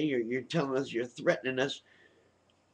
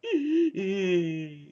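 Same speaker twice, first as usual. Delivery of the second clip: mock laugh; 5 semitones higher than usual. Mocking abusive husband.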